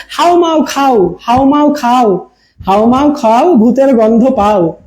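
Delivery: "হাও মাও খাও" chanted slowly through the nose; spooky nasal sing-song, slow; nasal ghost rhyme. Shown as a demonstration. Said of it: "আরেকটাও বলি".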